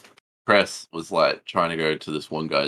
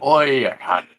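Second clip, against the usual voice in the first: high, raised Aussie holler in imitation; mock yell; 8 semitones higher, 4 dB louder, slower than usual. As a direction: shout it like an annoyed homeowner, playfully. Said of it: "Just yells out".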